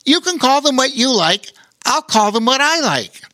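high, cheeky little otter; bright playful otter squeak; squeaky otter voice. A narrator's reading. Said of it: "brightened up".

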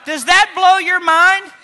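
slow, loud rhetorical challenge; sermon climax shout; preacher's shout. Real, not acted. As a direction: shout it at the pews.

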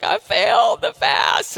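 slow, breathless imitation of a crying caller; lightly mimicked sobbing woman; mock crying. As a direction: act out a tearful confession, half-played. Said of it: "she was like".

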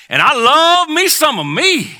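loud, high, drawn-out declaration; big, stretched-out proclamation; exuberant self-love. Shown as a demonstration. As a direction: belt it out slowly and proudly. Models a proud declaration for congregation.

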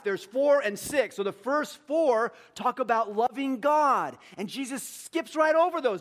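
loud, high, driving sermon delivery; preaching at full force. Acted. Pastor preaching to the church, loud and high.

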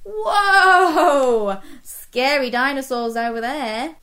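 mock scared. Reacts to child's dinosaur roar.

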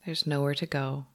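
normal talking voice

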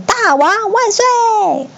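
high, long drawn-out cheer; jubilant cheer. A narrator's reading. Monkey troop hails its king, jubilant. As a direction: cheer it out high and stretched, overjoyed. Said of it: "无不欢欣鼓舞".

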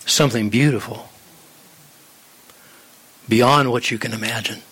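low, unhurried reassurance with long pauses; reassuring God. Acted. God answers the suffering believer, low calm reassurance. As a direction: speak low and steady, phrase by phrase. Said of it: "And God is saying".

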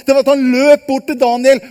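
ordinary delivery

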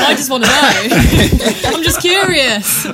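loud, high mock pleading through laughter; hammed-up desperate curiosity; comic exasperated yell. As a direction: cry it out, drawn out and laughing. Keeps up shouting-at-screen act, laughing.

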